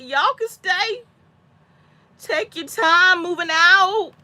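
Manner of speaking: high, slow, drawn-out coaxing; pleading. Retold or imitated. Acting out begging kids to stay.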